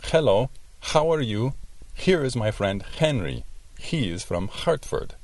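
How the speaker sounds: "Hello" and "Henry" with a harsh throat H like Spanish J; throaty H like J. Shown as a demonstration. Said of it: "puede sonar así".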